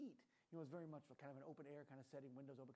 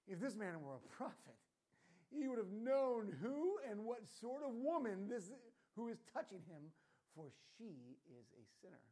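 put-on British accent; 5 semitones higher, 7 dB louder, slower than usual. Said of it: "I want to do it in a British accent".

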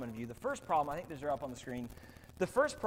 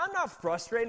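mock protest; 7 semitones higher, 6 dB louder than usual. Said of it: "It's like".

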